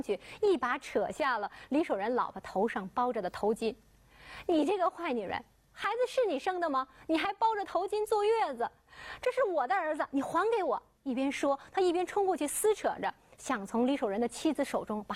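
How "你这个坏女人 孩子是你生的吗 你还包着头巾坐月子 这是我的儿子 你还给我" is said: higher, accusing outburst at the wife; angry accusation. Acted. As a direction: demand the baby back, voice rising. Liu Hehua confronting the wife.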